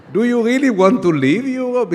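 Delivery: loud, high exasperated question; incredulous outcry. Real, not acted. Mocking Brexit to a live audience.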